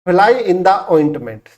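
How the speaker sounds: "Fly in the ointment" drawn out slowly word by word; slow, careful teacher delivery; slow headword. Shown as a demonstration. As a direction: announce the new idiom slowly for dictation. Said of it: "तो fish out of water का मतलब".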